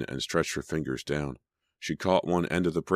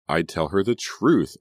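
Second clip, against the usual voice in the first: slow, emphatic, higher voice; louder on truth, deliberate and sure; firm declaration; 4 semitones higher, 6 dB louder than usual. A narrator's reading. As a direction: declare it with conviction. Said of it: "Emma declared".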